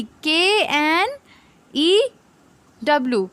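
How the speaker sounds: slow letter-by-letter spelling; spelling out slowly. Shown as a demonstration. Teacher spelling a word for students, slow and deliberate. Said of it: "और इस वाले की क्या थी?".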